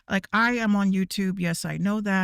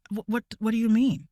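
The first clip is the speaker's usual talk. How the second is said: ordinary delivery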